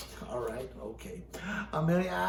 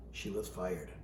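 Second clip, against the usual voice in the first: quiet; 13 semitones lower, 11 dB quieter than usual. A narrator's reading. Reading drops very low, soft.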